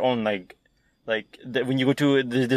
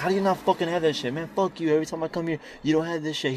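higher-pitched retold rant; mock angry customer; 5 semitones higher, 4 dB quieter than usual. Friend acting out a mad customer at the window, mildly angry. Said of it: "he got really mad".

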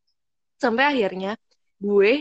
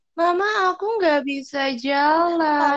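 slow, high overacted little-kid plea; dramatic child whine; 6 semitones higher, slower than usual. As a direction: whine to mom like a soap opera. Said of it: "alay banget". (rengekan anak kecil yang lebay)

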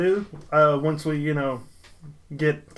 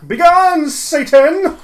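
hammed-up shout, much higher and louder; mock exorcism; 12 semitones higher, 9 dB louder, much slower than usual. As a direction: cast the devil out in a big mock yell.

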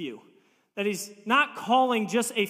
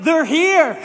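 high, loud they're-here alarm; excited loud warning; urgent announcement; 6 semitones higher, 10 dB louder than usual. Retold or imitated. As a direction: announce it like the enemy has landed. Warning wife about visitors.

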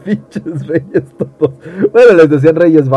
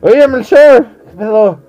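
high, loud stoned wise man; stoned king voice; 6 semitones higher, 5 dB louder than usual. Acted.

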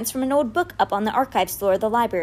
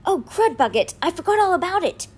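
high, alarmed outburst; animated "oh no" moment; dismayed realization; 9 semitones higher than usual. A narrator's reading. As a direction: blurt it out, suddenly remembering. Boy realizes he forgot, eyes widen.

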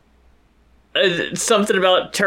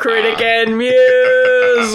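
ordinary talk, nothing marked

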